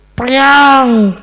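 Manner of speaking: high, loud crash sound; imitated glass crash. Bunyi kaca pecah ditirukan. (bunyi kaca pecah ditirukan keras)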